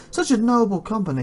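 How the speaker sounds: high, moved knightly voice; a touch of acted tearfulness; mildly tearful. A narrator's reading. Knight moved by noble company, high tearful lament. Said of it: "Tears well up in Quan's eyes".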